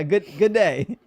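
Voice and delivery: loud, slow mock-Aussie greeting; Australian accent. Streamer caught off guard trying greetings, mock Australian accent. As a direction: test out an unsure Australian greeting. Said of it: "is that Australian?".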